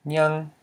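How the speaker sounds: nyang said high and level. Shown as a demonstration. Teacher repeats the new word, nyang on a high tone. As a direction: pitch nyang up high and hold it. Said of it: "this word is pronounced as".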